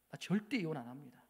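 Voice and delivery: hushed, half-whispered tearful vow; quiet voice of a crying wife, whispering her refusal; softly weeping wife refusing divorce; tearful plea. Retold or imitated. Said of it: "막 울면서 뭐라고 이야기하는지".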